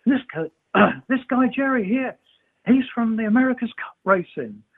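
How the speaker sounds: high-pitched imitation of calling a group over; announcing to everyone. Racer introducing sailor to his team, mimicked excited announcement. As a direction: proudly announce someone to the whole room. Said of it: "announced it to the whole team".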